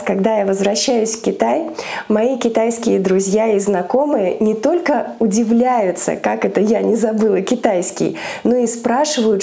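normal talking voice

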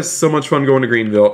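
normal talking voice

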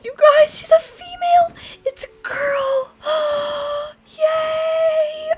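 very high, squeaky celebration; real giddy delight with gasps; thrilled squeal. Real, not acted. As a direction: squeal the news to your fans. Finds out dragon is female.

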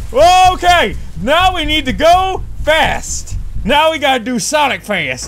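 high, loud rallying cry; rally yourself for a mad dash; hyped-up urgency. Real, not acted. Suddenly has to rush.

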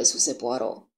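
usual speaking voice

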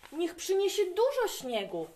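high excited shout about snow; excited call. A narrator's reading. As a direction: call it out eagerly to Dad. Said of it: "zawołałem".

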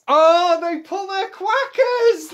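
surprised. Acted. Parent reacts to child's joke.

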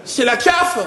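high, panicked yelp; panicked cry. Retold or imitated. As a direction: squeal it out in sudden fright. Friend panics at the alien. (cri de panique aigu, le pote a peur)

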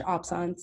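absence said the French way; French-style "absence" with an open second vowel; French pronunciation. Shown as a demonstration. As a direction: say "absence" as French, ending like "sahns". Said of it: "it's supposed to be French".

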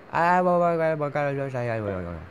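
long babbling wail falling from high and loud; mock wail. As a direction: start high and loud, babble downward till it fades.

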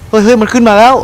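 high, loud warning cry; alarmed shout. Real, not acted. Gamer yells in alarm as the nurse climbs on. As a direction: yell out in alarm.

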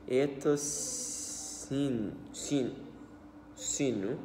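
usual speaking voice

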